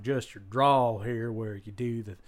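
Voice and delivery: slow Texan accent; demonstrating a slow Texas twang; Texan drawl. Shown as a demonstration. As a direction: stretch the vowels into a Texan drawl. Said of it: "your sort of Texan".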